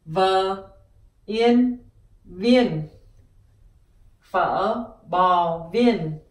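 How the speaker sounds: viên said slowly, built from vơ and in; Vietnamese viên. Shown as a demonstration. Teacher sounding out viên for learners. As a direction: join vơ and in into viên. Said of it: "and you have viên".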